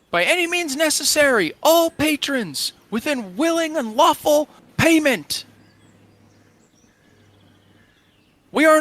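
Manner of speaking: high, loud proclamation, broken into phrases; slow, emphatic shouted declaration; yelling back. Acted. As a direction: read out the contract across a distance. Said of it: "he yells back at Morgan".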